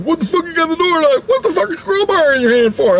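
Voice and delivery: very high, squawked questions; baffled arrival. Retold or imitated. James finding locked door and crowbar, baffled arrival. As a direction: imitate an incredulous coworker, hammed-up.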